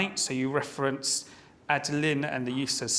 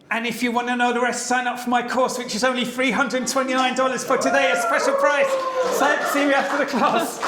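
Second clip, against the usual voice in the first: loud, high, fast hard-sell pitch; hammed-up infomercial hard sell; salesy course pitch; 10 semitones higher, 7 dB louder than usual. Evaluator joking about how to sell her course, loud and high. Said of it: "you should say".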